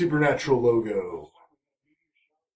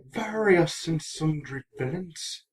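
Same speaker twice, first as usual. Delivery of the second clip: put-on light Irish accent, slow and soft; 3 semitones higher, 8 dB quieter than usual. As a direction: lilt it like an Irishman, easy and slow. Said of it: "as in if it was just said with an Irish accent".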